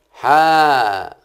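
حا sounded out loud and held; syllable demo. Shown as a demonstration. Teacher sounding out the first syllable of حاتم loudly. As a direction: hit the syllable حا loudly and alone. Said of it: "يبقى فتحة".

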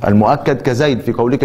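ordinary talk

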